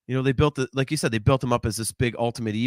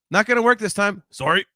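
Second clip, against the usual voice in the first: higher-pitched, lightly raised call; mild mock yell; 5 semitones higher, 4 dB louder than usual. Imagined bystanders outside the firehouse, half-yelled. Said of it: "they just yell out".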